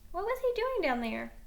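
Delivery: usual speaking voice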